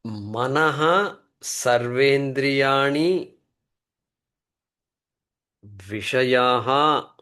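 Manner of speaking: very slow chant-like reading of the Sanskrit; slow recitation. Shown as a demonstration. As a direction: read the verse slowly for the class to repeat. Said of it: "Watch the moolam".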